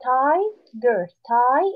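Tiger split as tie and ger, then Tie alone; long vowel in Tie. Shown as a demonstration. Teacher recapping the open syllable, long i in Tiger, Tie. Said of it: "It has a long vowel sound".

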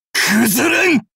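high, strained-out shout; defiant cry. Acted. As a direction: refuse defeat with a yell. Defiant cry when hit.

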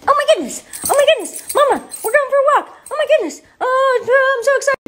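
very high, loud, frantic puppy; high-pitched talking pet, bursting with excitement; excited dog voice. Pretend. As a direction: squeal like an overjoyed dog. Voices the dog before a walk.

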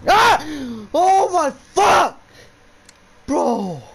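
very high, loud shriek, dragged out slowly; startled scream. Real, not acted. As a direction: scream in real fright. Jump scare in horror game.

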